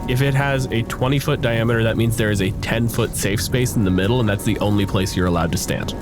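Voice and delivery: ordinary delivery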